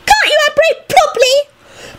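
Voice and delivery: extremely high, scolding squeak; squealing elf. A narrator's reading. Elf scolds the man who landed badly, squealing. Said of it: "squealed one of the elves".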